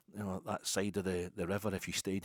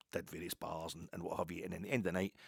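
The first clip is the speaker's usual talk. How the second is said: normal talking voice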